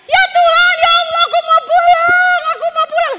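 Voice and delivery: high, loud frightened wail, begging to go home; panicked scream to God; terrified crying out. Retold or imitated. Preacher reenacting souls in barzah, high loud terrified wail. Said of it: "Semua berteriak termasuk saya".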